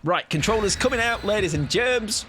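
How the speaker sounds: mock compere welcoming the crowd; showman announcement. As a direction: roll it out like a ringmaster.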